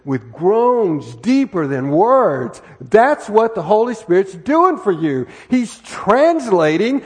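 loud and drawn-out, every word leaned on; slow, loud preaching. Real, not acted. Preacher explaining the Spirit to the congregation, slow and loud.

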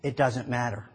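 low, sly grinning cat. Acted. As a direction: say it low with a wide grin. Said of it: "with his grin growing wider".

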